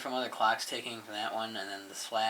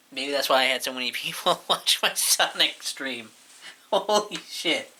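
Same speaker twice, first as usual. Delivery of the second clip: real laughter breaking into the words, louder; laughing; 4 semitones higher, 8 dB louder than usual. Real, not acted. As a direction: crack up while talking, voice jumping higher by the end. Amused by discovering why viewers came.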